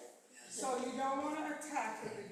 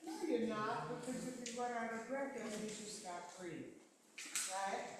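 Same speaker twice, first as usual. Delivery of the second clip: ordinary talk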